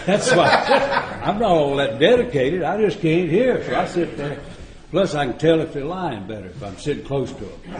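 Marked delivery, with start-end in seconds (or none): 0.0-4.4 laughing. Real, not acted. Joking about front row seat.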